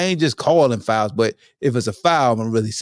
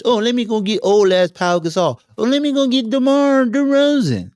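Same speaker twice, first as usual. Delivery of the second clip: mocking voice; 10 semitones higher than usual. Mocking Popovich signing old stars.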